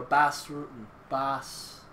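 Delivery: Bass said with a Boston accent. Shown as a demonstration. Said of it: "Sort of like if you say it with a Boston accent".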